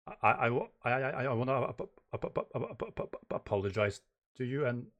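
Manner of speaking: heavy acted stutter on apologize. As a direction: trip repeatedly over the first syllables. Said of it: "how I've done it before with, uh, stuttering".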